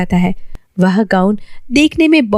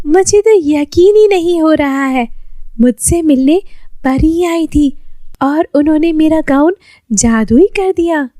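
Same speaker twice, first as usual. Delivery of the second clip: put-on higher, excited girlish voice; can't believe your luck; Lily thrilled about the magic gown, higher voice; 7 semitones higher than usual. Lily overjoyed alone.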